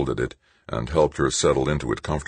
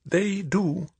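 put-on old woman voice.

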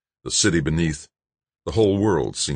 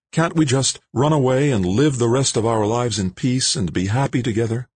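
higher, yearning woman's voice; Jane pleading; 7 semitones higher than usual. Acted. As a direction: beg him to run away with her.

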